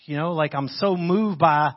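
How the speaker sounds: ordinary talk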